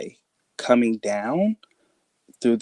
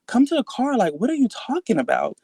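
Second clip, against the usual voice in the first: exasperated mom; 11 semitones higher than usual. Retold or imitated. Mom's impatient retort in story.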